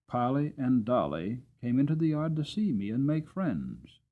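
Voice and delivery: usual speaking voice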